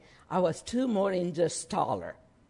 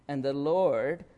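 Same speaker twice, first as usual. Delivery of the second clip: normal talking voice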